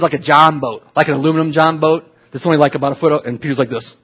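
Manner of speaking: normal talking voice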